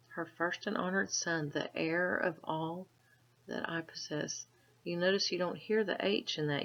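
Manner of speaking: plain reading voice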